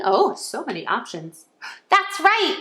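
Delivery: normal talking voice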